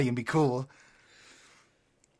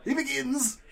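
Joker voice; 7 semitones higher, 5 dB quieter than usual. Acted. Joker character.

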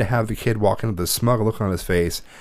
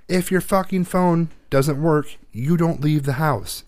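slow, higher, every word spelled out; slow stern lecture; 7 semitones higher, much slower than usual. Retold or imitated. A mother laying down the rule to her son, slow and stern. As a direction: lay down the law word by word.

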